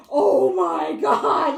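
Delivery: slow, loud dismay; a slow, loud stunned wail; horrified groan. Retold or imitated. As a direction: drag it out in disbelief. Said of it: "one of the family members went".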